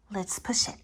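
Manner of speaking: put-on conspiratorial whisper to the child; sneaky whispered dare. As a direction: whisper it like a secret.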